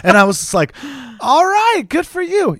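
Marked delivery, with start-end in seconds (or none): 1.2-2.6 very high-pitched awkward approval; mock supportive. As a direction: react with overdone, squeaky-high acceptance. Acting his reaction to the escort reveal.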